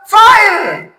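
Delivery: high, loud sudden yell; shout. Acted. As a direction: shout the word as the punchline. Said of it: "sabi ko siya". (biglang malakas na sigaw)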